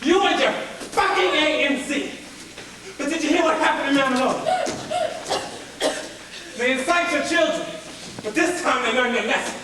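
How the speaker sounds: loud, goading tirade; harsh, shouted accusation from a captor; angry interrogator. Acted. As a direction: taunt the prisoner at full voice. Interrogator goads the prisoner.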